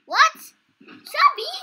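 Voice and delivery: high, loud surprised questions; startled what. Real, not acted. Gamer surprised by a friend's name for the creature, startled. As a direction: sound caught off guard.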